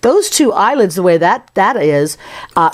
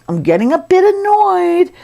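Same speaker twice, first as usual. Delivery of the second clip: high, put-on peeved voice; mock annoyance, pitched way up; a bit annoyed; 12 semitones higher than usual. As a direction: voice a mildly irritated cartoon character. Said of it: "he's sort of looking like".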